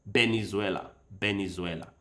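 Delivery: VENEZUELA begun with a B, Benezuela, low; Spanish B sound. Shown as a demonstration. Learner showing how Spanish speakers say it, B sound. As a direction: start Venezuela with a soft Spanish B. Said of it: "pronounce B like a V sometimes".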